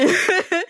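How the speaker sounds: brief rueful chuckle; short laugh. Real, not acted. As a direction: let a small laugh slip out. Wry aside on compromise.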